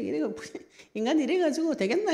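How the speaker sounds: high-pitched, laughing through the words; laughing scold. Retold or imitated. Believer grumbling to God about foolish kings, laughing.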